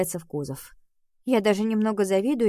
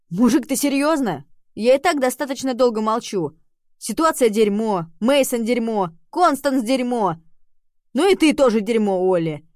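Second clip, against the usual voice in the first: angry shouting; 5 semitones higher, 7 dB louder than usual. Acted. Rem bursts out at Oliver.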